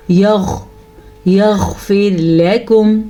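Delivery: heavy throaty غ in یغفر; full-mouthed ghain. Shown as a demonstration. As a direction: hold a thick غ, then یغفر لَكُمْ slowly. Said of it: "تو میں نے اس کو کیسے غین کو پر کیا؟".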